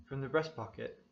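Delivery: ordinary delivery, nothing marked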